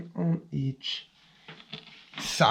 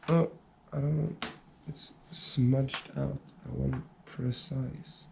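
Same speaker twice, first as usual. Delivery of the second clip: muttering to self; 8 dB quieter than usual. Real, not acted. Muttering while working.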